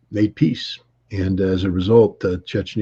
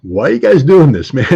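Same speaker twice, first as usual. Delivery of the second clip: higher and louder, half-laughing disbelief; alarmed outburst; 6 semitones higher, 8 dB louder than usual. Retold or imitated. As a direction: blurt it out in mock alarm. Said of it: "I'm just like".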